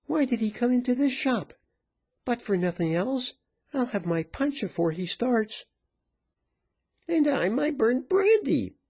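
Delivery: high-pitched clamouring women; rowdy women crying out in turn; clamorous cries. A narrator's reading. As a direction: call out demands in a high character voice. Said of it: "So cried they all".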